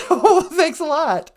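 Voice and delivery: high granny voice, laughing. Pretend. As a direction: cackle it out as the granny. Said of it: "Nana Bonjour".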